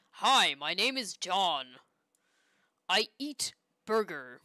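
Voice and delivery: loud, slow, exaggerated American accent. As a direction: put on a booming, badly faked American accent. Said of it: "That's my American accent".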